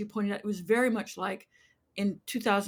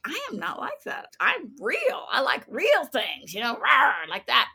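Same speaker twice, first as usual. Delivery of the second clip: put-on defiant young self; 4 semitones higher, 6 dB louder, slower than usual. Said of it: "And I was like".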